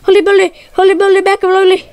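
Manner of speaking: high, frantic repeating; panicked stammering. Real, not acted. Gamer stammering in panic mid-fight, frantic repeats. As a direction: babble in gaming panic. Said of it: "I'm, like, stuttering right now".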